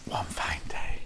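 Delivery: whispered to herself, unvoiced. Shown as a demonstration. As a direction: breathe the words with no voice. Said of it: "That's a song, isn't it?".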